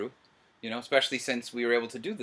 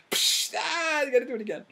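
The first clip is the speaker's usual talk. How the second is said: a hint of a pushy director, much higher; mock demanding director. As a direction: lightly send up your own bossy director voice. Mocking his own director self.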